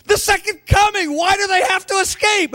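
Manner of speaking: high, astonished questioning; incredulous question. Retold or imitated. Preacher voicing his own disbelief, high and incredulous. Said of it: "I go".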